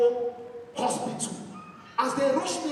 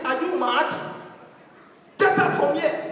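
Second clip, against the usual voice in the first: furious teacher yelling, louder and higher; angry shout; 5 semitones higher, 7 dB louder, slower than usual. Retold or imitated. Teacher throwing the boy out, in a loud angry shout. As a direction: bellow at the cheeky student.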